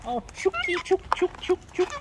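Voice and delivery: high sing-song chook chook call; calling chickens. Real, not acted.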